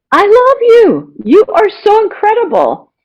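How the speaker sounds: mean every word, lifted high; heartfelt, excited. Retold or imitated. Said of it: "I started thinking of all the positive things".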